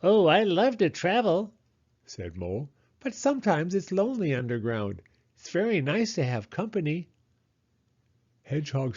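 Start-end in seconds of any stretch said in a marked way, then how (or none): 0.0-7.1 high, cheerful mole; Mole's voice. Acted. Mole telling Hedgehog she loves to travel, high and cheerful. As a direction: chirp happily as Mole.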